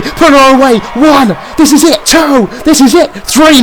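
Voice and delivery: will the pin home, pitch climbing; excited count. Real, not acted. Commentator counting Marie's near-fall, excited.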